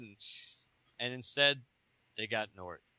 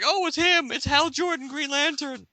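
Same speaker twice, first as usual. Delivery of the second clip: very high, thrilled cry; excited bystanders; 14 semitones higher, 4 dB louder than usual. Acted. Bankers seeing Hal Jordan arrive. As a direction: gasp it out like starstruck fans. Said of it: "It's like".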